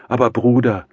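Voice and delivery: high, loud, indignant sister; sudden heated reproach; flaring up. A narrator's reading. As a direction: flare up at your brother. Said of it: "fuhr Awdotja Romanowna auf".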